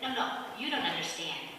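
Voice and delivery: soft, low unbothered reply; calm castaway reassuring his panicked friend. Acted. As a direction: say it totally relaxed. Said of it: "was so calm".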